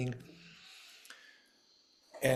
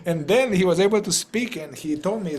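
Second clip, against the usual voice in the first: ordinary talk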